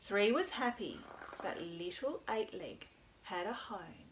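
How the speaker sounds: storytime narration; reading to children. A narrator's reading.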